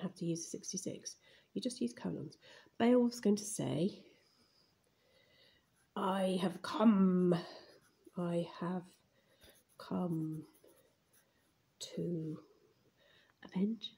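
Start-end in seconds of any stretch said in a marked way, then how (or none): 6.0-13.9 slow, word by word; halting, slow writing-along voice; drawn-out dictation. Real, not acted. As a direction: stretch each word as you write it. Writing Beowulf's line aloud.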